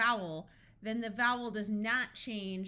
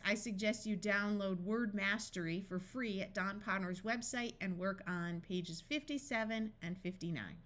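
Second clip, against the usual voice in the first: ordinary delivery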